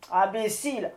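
a loud scornful cry of imbécile; the wind lord snapping at the foolish miller; angry outcry. Acted. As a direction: spit the insult out. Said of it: "s'écria monsieur le Vent".

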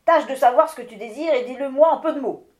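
loud, fast, impatient command; the wind lord demanding a short answer; impatient order. Acted. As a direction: hurry him up curtly.

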